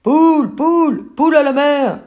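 crié fort et aigu; cris perçants. Acted. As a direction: alerter tout l'équipage. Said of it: "des cris perçants". (marins qui crient l'alerte, fort et aigu)

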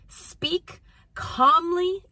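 slow, deliberate words with space between; unhurried coaching emphasis; calm, measured emphasis. Shown as a demonstration. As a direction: say it calmly and very slowly. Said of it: "I would argue quietly".

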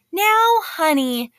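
sugary high, slow mock-sweet teacher; drawn-out syrupy threat, sweet on the surface; real sweet. Mean teacher sweetly calling the boy before punishing him, slow and sugary. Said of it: "real sweet".

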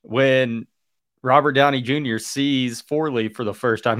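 usual speaking voice

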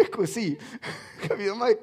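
ordinary talk

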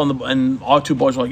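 normal talking voice

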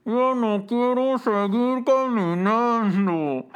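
put-on high, slow, whining child; whiny kid voice. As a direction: whine like a tired boy. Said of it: "mi nombre es Russell".

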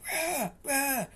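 lightly voiced robber's mock battle cry; mock yell. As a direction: squeak out a silly threatening roar. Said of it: "yelling or whatever".

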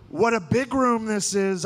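slow, high put-on Trump cadence; Trump impression. Shown as a demonstration. Comic showing the audience his Trump voice, slow and high. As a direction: describe it like Trump would. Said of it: "how to do his voice".

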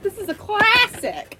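high, emphatic disbelief, stretching CLASSIC; playfully scandalized exclamation; mock outrage. Mock outrage at friend never having tried it.

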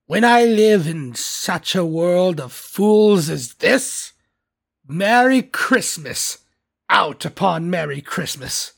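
slow, heavy, drawn-out indignation; exasperated Scrooge. A narrator's reading. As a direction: rail at it, stretching the words. Scrooge rails at Christmas.

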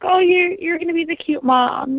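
high, playful mock teasing voice; teasing friends. Friends joking she'll be the cute mom, high playful teasing. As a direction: tease her like a friend poking fun. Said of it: "my friends would joke".

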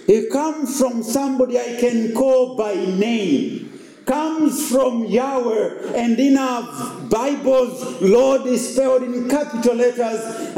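a pastor preaching at full stride, rising and falling. Acted. Pastor preaching to the congregation, earnest. Preaching a sermon on Psalm 121.

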